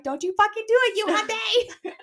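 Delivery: high-pitched mock road-rage shout; imitated yelling at cars. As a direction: holler it at a car, hammed up. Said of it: "we'll both be yelling at cars".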